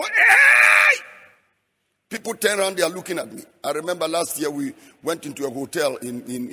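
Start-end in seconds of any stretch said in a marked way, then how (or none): 0.0-1.0 a piercing, very high burst of a shout; sudden shout. Retold or imitated. Preacher showing the congregation his restaurant shout, loud and high. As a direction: release a joyful cry right there in the restaurant. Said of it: "I feel like shouting".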